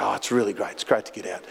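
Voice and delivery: a hint of the gruff stranger, low and soft; imitating a raspy man. Photographer on the beach answering the pastor, low and raspy. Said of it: "He had a very raspy voice".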